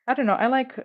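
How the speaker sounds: ordinary delivery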